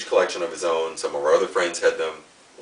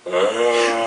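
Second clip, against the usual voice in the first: zombie noise; 4 semitones higher than usual. Shown as a demonstration. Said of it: "doing zombie noise".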